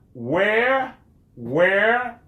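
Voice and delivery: "where" lifted like a model word to repeat; pronounce where. Shown as a demonstration. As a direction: contrast "where" with "were" for pupils. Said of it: "and were".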